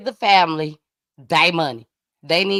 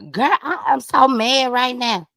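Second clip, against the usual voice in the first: higher, louder mock fuming; mad friend; 7 semitones higher, 5 dB louder than usual. Host quoting her friend's phone call, acted as mad. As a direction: imitate a worked-up friend venting. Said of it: "one of my friends gave me a call".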